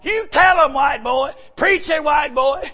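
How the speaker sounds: high, loud call of encouragement; church sisters cheering. Retold or imitated.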